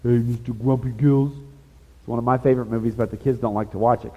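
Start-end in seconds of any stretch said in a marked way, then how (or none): 0.0-1.3 lightly voiced cheerful cartoon fish; a hint of a cheerful sidekick teasing; movie quote impression. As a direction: quote the chipper friend in a playful voice. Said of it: "Remember that movie?".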